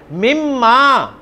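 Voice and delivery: मिम्मा drawn out very slowly, doubled m held; careful demo. Shown as a demonstration. Teacher modelling मिम्मा for the class, slow and deliberate. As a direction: stretch मिम्मा syllable by syllable. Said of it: "मिम्मा कह देंगे".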